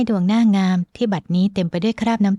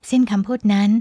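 ordinary delivery, nothing marked

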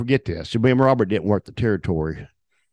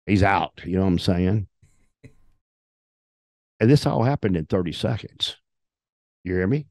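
ordinary delivery

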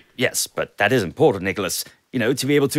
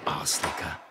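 muttered aside; 8 semitones lower, 11 dB quieter than usual. Acted. Secretary mutters insult after him.

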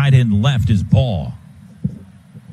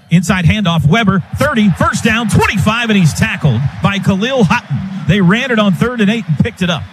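excited play-by-play; 5 semitones higher than usual. Real, not acted. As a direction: call the big gain building up. Live play-by-play call rising.

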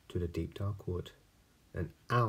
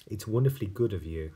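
fast mouse voice; 2 semitones higher, 6 dB louder, much faster than usual. Acted. Mouse declines snake.

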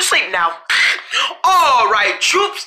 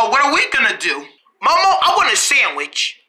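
usual speaking voice

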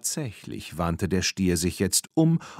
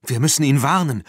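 raised, louder voice of alarm; urgent cry; 5 semitones higher, 5 dB louder, faster than usual. A narrator's reading. Tina to her friends as the bull charges, urgent cry. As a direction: cry out to the others. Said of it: "schrie Tina".

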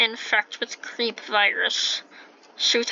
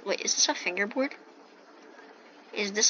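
ordinary talk

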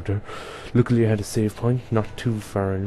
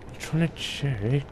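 ordinary talk, nothing marked

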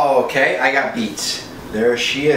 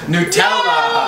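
high, slow gleeful shout; excited shout; much slower than usual. Real, not acted. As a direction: stretch it out in triumph. Gleeful at drawing Nutella.

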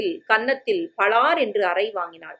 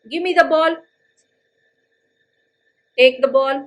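calling across; 5 semitones higher, 4 dB louder, much slower than usual. Acted. Young man calling over fence.

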